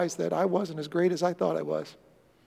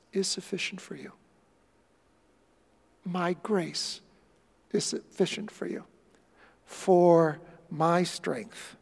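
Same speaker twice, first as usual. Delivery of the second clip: soft, slow, gentle voice of God; tender divine answer; 5 dB quieter, slower than usual. Acted. Preacher voicing God reassuring Paul, soft and slow. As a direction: speak grace tenderly.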